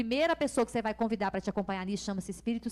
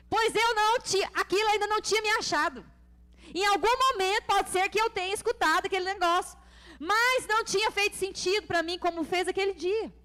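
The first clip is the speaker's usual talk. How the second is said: high, loud, emphatic preacher; raised-voice sermon fervour; impassioned preaching; 7 semitones higher, 4 dB louder than usual. Real, not acted. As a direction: drive each phrase out to the congregation. Preaches at full force.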